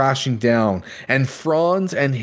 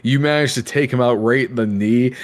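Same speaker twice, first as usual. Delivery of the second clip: ordinary talk, nothing marked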